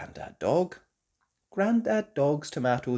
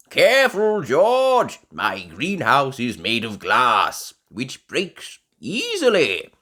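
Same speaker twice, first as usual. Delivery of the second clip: loud, higher grandpa voice, careful now; warning grandpa; 4 semitones higher, 11 dB louder than usual. A narrator's reading. Grandpa Pig warns George near the greenhouse, loud and cautioning.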